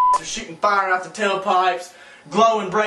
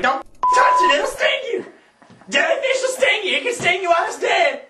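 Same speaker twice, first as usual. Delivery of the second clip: frantic warning; 14 semitones higher than usual. Retold or imitated. High, urgent warning to the girl about the jellyfish, breaking into laughs. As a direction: panic over a jellyfish, laughing at yourself.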